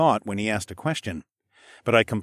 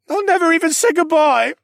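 high, loud rushed blurt; 8 dB louder than usual. Acted. Boy blurts his guilt to Alex, high and loud. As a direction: let it burst out. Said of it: "he blurted out".

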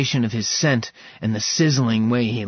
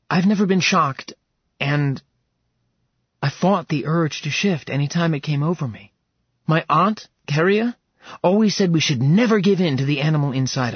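put-on lightly raised young woman's voice, earnest; a woman confessing her fear, pitched up a little; Serafina's voice; 5 semitones higher than usual. Serafina telling Max about her aunt, earnest.

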